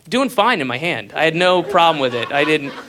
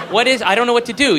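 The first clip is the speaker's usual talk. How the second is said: panicked; 8 semitones higher, 5 dB louder, faster than usual. Retold or imitated. Panicked inner thoughts at table.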